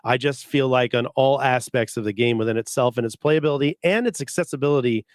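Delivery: ordinary delivery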